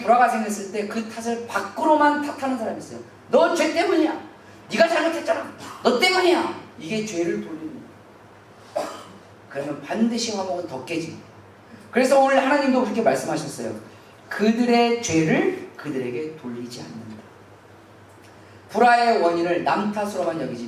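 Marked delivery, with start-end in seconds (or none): 3.3-6.6 high, loud accusing jab; mock blaming. Acting out blaming family member. 14.3-17.2 slow, weighty voice of God; solemn scripture quote. Shown as a demonstration. Quoting God's words.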